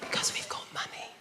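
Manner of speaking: whispered secret; hushed posh confession. Shown as a demonstration. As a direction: breathe it as a hush. Joke about quiet posh talk.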